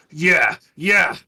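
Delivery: a noble hurling an insult; insulting shout. Acted. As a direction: shout the jab at him.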